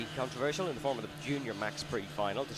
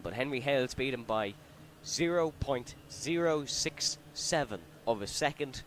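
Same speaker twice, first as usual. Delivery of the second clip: normal talking voice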